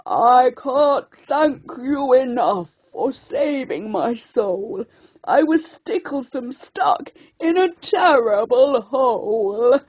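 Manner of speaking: put-on old lobster gently turning down her small friend; cracked ancient voice. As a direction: creak it out like an ancient sea creature. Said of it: "cracked and ancient".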